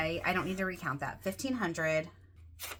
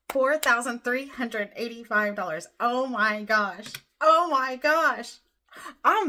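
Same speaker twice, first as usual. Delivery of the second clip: thrilled announcement; 4 semitones higher, 7 dB louder than usual. Real, not acted. Announcing the final savings total.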